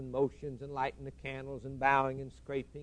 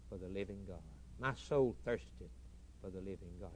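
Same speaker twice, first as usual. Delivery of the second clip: much softer, low and intimate; hushed longing; 7 semitones lower, 11 dB quieter than usual. Acted. Preacher reciting the psalm of longing, hushed and low. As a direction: breathe the longing out quietly. Psalm line sinks to a hush.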